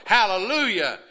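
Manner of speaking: high, loud hallelujah cry; shouting praise. Pretend. Preacher imitates folks praising as the plate passes, shouting praise. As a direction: exclaim it like a mock praise shout. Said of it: "folks started shouting and praising".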